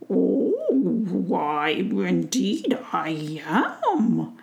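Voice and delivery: slow, drawn-out gentle giant; tree giant. Acted. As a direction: let the old tree speak slowly.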